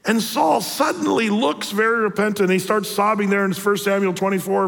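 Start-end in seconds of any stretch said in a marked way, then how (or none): none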